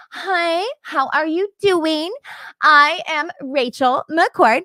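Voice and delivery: high, slow, sugary greeting; gushy celebrity interviewer. Shown as a demonstration. Showing the wrong voice for a Bloomberg interview, high and slow gush. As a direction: lay on the starstruck host act thick. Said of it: "I'm not gonna talk like that".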